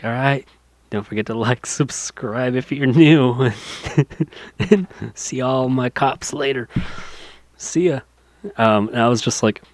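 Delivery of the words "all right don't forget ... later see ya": mock YouTuber outro. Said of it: "is like".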